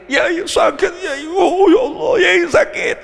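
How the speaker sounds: high, hammed-up wail of pain; wailing complainer. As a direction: overdo a patient whining to the cleric. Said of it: "Datang ke kiai". (merengek kesakitan berlebihan)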